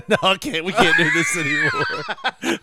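very high, laughing squeal; laughing protest. Real, not acted. Protesting the impressions while laughing hard. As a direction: cry it out through laughter.

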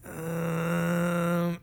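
long, drawn-out hesitant um; real unsure. Retold or imitated. Cashier stalling at a restroom request, real unsure. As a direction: stall on one held, level um. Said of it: "real unsure, like".

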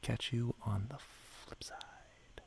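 whispering. Acted. Sign-off whispered.

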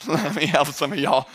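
usual speaking voice

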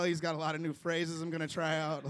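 normal talking voice

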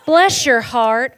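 loud, high, slow South Carolina drawl; Southern put-down. Retold or imitated. South Carolinian insulting someone politely, slow Southern drawl. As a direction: draw out a Southern insult disguised as kindness. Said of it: "I'm from South Carolina, if you haven't picked up on that".

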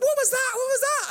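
very high, mock-alarmed kid voice; startled. Imitating a small boy after nearly drowning, startled. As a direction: squeal it in bewilderment.

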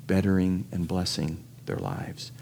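usual speaking voice

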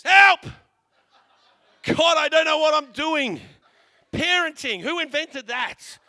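loud, high desperate yell for help; a frazzled parent's shouted prayer; desperate cry. Retold or imitated. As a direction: cry out to God half laughing. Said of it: "They go like this".

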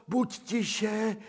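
buď tiše cooed high and sing-song; sing-song hush to a lapdog; cooing hush. Acted. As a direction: hush the dog in high baby talk. Hushing the dog high.

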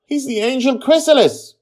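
very high, loud insistent cry; shouting. A narrator's reading. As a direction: shout it out to silence the room. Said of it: "Joy shouts at the top of her voice".

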